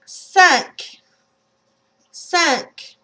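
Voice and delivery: "Cinq" as sank, nasal vowel, no N. Shown as a demonstration. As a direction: say "Cinq" through the nose. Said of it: "Try a few words".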